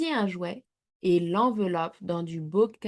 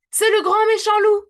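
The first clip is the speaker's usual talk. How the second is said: much higher and louder panicked cry; frightened shout; 13 semitones higher, 10 dB louder, faster than usual. A narrator's reading. Maman Tire-Bouchon sees the wolf at the door, shouting in alarm. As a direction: scream the warning at the family. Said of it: "s'écrie-t-elle".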